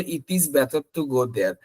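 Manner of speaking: slow Malaysian English; Malaysian accent. Retold or imitated. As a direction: sound out each word like a Malaysian speaker. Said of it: "তখন তারা বলে".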